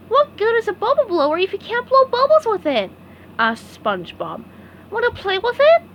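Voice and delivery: high, bright cartoon sponge offer; cheerful SpongeBob. SpongeBob offers Patrick the bubble blower, high cheerful. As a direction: invite a friend to play.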